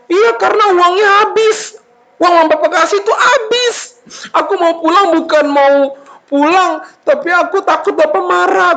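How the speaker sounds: high, hammed-up whining plea; tearful confession. Son confesses spent money to papa, tearful whining. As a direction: plead in a mock-crying voice.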